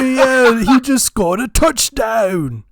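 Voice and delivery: loud, high excited British commentator. Retold or imitated. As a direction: call the touchdown like a hyped British announcer. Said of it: "I wanna hear a British guy being like".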